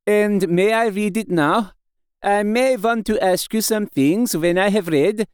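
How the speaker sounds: put-on foreign-accented old professor, pleading politely; accented foreign doctor, courteous. Doctor begs Mina to read it for him, courteous accented voice. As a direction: play the courteous foreign doctor asking a favour.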